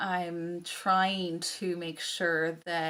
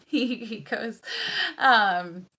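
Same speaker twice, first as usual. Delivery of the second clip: normal talking voice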